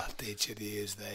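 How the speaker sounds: low, very soft film-mentor impression; Uncle Angus from Braveheart. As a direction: intone the lesson low and hushed. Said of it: "That would have made Braveheart".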